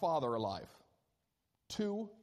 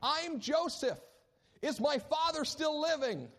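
a higher, eager Joseph reveal; acted Joseph; 10 semitones higher, 3 dB louder than usual. Pastor acting Joseph revealing himself to his brothers, eager. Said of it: "He says to the guys".

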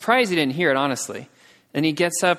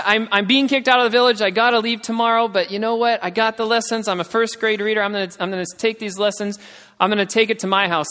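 higher, louder, pressing on eagerly; urgent determination; 6 semitones higher, 4 dB louder than usual. Retold or imitated. Banished woman vowing to take lessons home, urgent determination. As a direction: voice her resolve in a raised, insistent tone.

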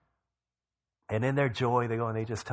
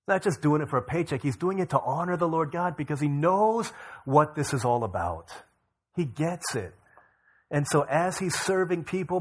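louder, higher, driving preacher voice; rising sermon fervour; 5 semitones higher, 4 dB louder than usual. Acted. Preacher to congregation about Matt's faithful work, fervent and building. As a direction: preach it with growing fire.

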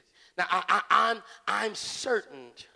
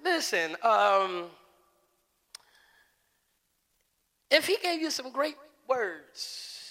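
polite servant; 4 semitones higher than usual. Acted. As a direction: coax him kindly, humbly. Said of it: "Real polite".

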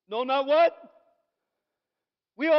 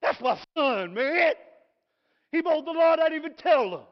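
proud dad cheer; 6 semitones higher, 9 dB louder than usual. Retold or imitated. Father praising son who mowed the lawn, loud proud cheer. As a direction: whoop it up, high and loud. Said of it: "I'd be like".

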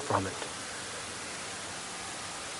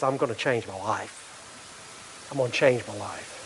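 low, soft, slow resolve; quiet repentance; 5 semitones lower, 9 dB quieter, slower than usual. Acted. Repentant sinner vows a changed life, quiet resolve. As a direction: say it humbly, lower and softer. Voices a cry of true repentance.

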